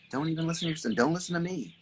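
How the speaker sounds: usual speaking voice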